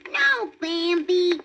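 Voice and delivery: high little rabbit asking; baby rabbit voice. A narrator's reading. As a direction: voice a small eager bunny. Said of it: "said Thumper".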